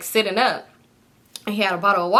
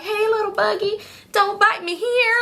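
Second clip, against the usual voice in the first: put-on baby talk; 11 semitones higher than usual. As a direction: coo it at a tiny bug. Mock baby talk to a bug.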